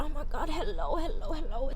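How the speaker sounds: soft, giggly greeting; laughing softly. Real, not acted. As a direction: coo it through a laugh. Gushing over her sock haul.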